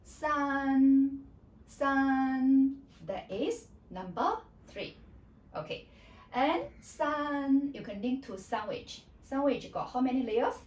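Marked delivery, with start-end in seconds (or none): 0.0-2.8 "san" sustained loud and high, dead level; steady high tone. Shown as a demonstration. Teacher corrects the dropping mistake, steady high tone. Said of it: "Don't go up or go down". 6.8-7.7 "san" high and level; high flat tone. Shown as a demonstration. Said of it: "keep at that pitch".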